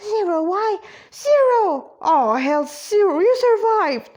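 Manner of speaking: high, loud cries of amazement; astonished exclamation. A narrator's reading. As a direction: burst out in disbelieving joy.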